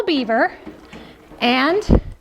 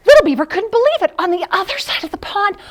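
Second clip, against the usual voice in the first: amazed; 8 semitones higher, 4 dB louder than usual. Acted. Excited story beat.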